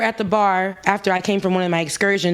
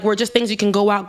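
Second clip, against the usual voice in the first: normal talking voice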